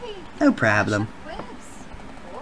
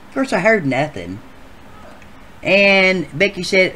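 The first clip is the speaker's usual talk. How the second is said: normal talking voice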